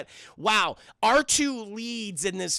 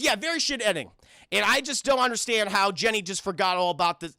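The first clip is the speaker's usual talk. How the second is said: usual speaking voice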